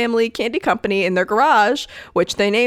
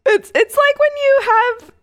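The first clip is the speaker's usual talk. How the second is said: laughing; 4 dB louder than usual. Real, not acted. Restarting through laughter.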